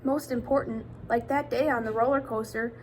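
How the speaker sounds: usual speaking voice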